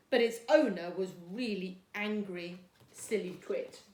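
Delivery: put-on storytime reading for young children; gentle storybook read-aloud for Year two; reading a story. A narrator's reading.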